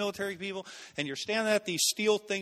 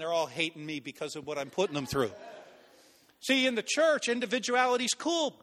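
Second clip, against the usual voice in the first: ordinary talk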